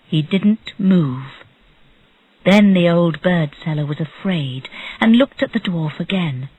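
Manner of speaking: ordinary delivery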